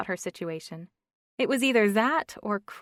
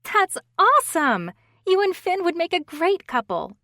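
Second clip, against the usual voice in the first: much higher and louder, thrilled; squealing excitement; 11 semitones higher, 11 dB louder than usual. A narrator's reading. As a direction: squeal it with delight. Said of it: "Stephanie squealed in her ear".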